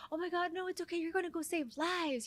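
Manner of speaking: high, gushing, laughing mock praise; gushing sisters. Sisters cheering her on about saving lives, high and gushing. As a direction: gush over her like proud sisters. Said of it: "they're like".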